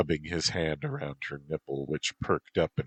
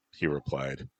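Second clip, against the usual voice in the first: ordinary delivery, nothing marked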